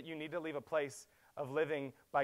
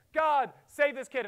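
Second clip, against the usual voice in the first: high, loud, slow desperate plea; crying out; 11 semitones higher, 10 dB louder, slower than usual. Retold or imitated. Preacher voicing Elijah begging God, desperate cry. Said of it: "Elijah's crying out and saying".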